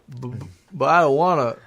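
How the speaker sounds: put-on stuttering, childlike reluctance; Forrest Gump. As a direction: balk haltingly like a simpleton. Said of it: "Forrest, I".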